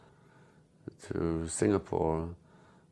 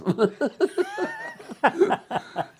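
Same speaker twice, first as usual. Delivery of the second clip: hearty laugh; 4 dB louder than usual. Real, not acted. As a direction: burst of high laughter. Laughs at his own punchline.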